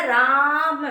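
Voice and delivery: slow राम with a long-held आ; drawn-out call. Shown as a demonstration. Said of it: "हमारा जो उच्चारण है".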